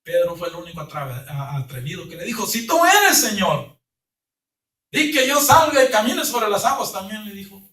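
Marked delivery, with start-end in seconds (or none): 2.5-7.2 louder, much higher eager plea; eager call. Acted. Peter calling to Jesus from the boat, louder and much higher. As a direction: call across the water, bold and eager.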